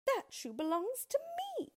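very high, insistent protest; indignant girl. Acted. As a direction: insist it's hers. Dorothy argues with witch.

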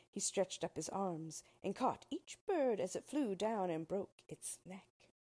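ordinary delivery, nothing marked

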